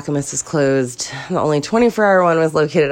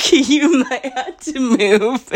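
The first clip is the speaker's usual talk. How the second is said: higher, slow words squeezed through laughter; hysterical laughing; 7 semitones higher, much slower than usual. Real, not acted. Woman listing her life's disasters, hysterical laughing. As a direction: crack up midway through the warning. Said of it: "sidetracked with my hysteria".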